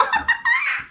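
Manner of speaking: shrill burst of giggling; high squeaky laugh. Real, not acted. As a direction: cut loose with a squeaky giggle. Laugh after joke.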